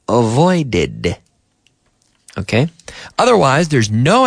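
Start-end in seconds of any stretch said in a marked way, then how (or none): 0.1-1.2 avoided with the extra syllable -ed clearly sounded. Shown as a demonstration. Teacher modelling the past tense for students, extra syllable clear. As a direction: say avoided, stressing the added ed syllable. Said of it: "we get an extra syllable in the past".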